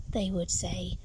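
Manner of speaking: ordinary delivery, nothing marked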